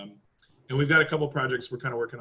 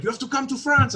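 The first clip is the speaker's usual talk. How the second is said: higher-pitched imitation French accent; 10 semitones higher than usual. French buddy inviting him to France, French accent. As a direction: mimic a Frenchman's English. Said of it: "he was like".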